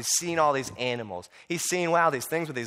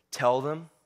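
deep, slow, solemn divine voice; God speaking, grave and unhurried; booming voice of God; 4 semitones lower, slower than usual. Acted. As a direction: pronounce it low and measured, with weighty pauses. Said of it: "that booming voice says".

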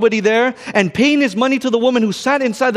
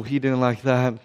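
normal talking voice

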